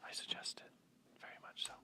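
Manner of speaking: whispered asides off mic. Real, not acted. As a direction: keep it a hushed whisper.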